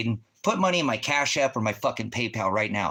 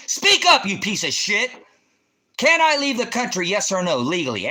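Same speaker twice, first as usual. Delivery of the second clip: screamed far higher and louder; enraged scream; 8 semitones higher, 6 dB louder than usual. Real, not acted. Streamer screaming at the viewer to answer. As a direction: explode at him full force.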